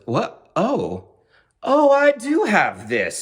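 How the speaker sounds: squeaky high stunned delight; surprised realization. Retold or imitated. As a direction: pitch leaps high, slow dawning "oh, I do have this". Jim realizes he has winning hand.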